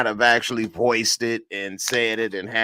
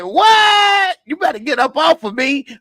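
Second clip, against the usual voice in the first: much higher, louder hammed-up voice; character impression; 5 dB louder than usual. Host acting out the show character's scene, high hammed-up impression. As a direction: play it big and startled, way up high. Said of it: "That's my impression".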